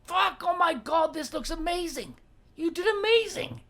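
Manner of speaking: high, loud, delighted gushing; over-the-top thrilled partner; gushing praise. Shown as a demonstration. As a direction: rave about what he did.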